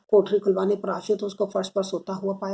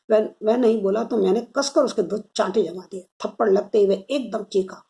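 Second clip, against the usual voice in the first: plain reading voice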